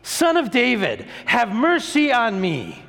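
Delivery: high-pitched, drawn-out plea; high, pleading cry across a crowd. Acted. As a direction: call out as a blind beggar begging to be heard. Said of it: "he shouted that out".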